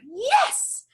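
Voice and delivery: high, loud cheer of triumph; girl's triumphant yes; cries out. Acted. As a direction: shout it like she won. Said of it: "she cries".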